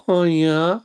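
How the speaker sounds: high sultry "Oh, yeah" as Pat; a breathy high mock croon; Pat impression. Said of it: "but with Pat going".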